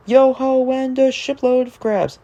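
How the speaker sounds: put-on high hearty old sea dog calling out; sing-song shanty refrain, hearty; salty sailor voice. Narrator voicing Salty the dock diesel, hearty old sailor.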